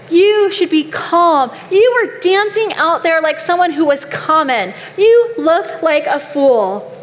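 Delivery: acted disdain, drawing out the insults; scornful scolding. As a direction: scold him like an appalled wife. Acted scolding of David by wife.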